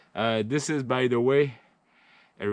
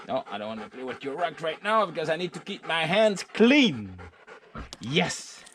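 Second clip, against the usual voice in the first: higher sing-song pet voice; talking to the dog; 5 semitones higher than usual. Real, not acted. To his dog who wants to play, higher pet-talk voice. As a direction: coax the dog away, high and playful.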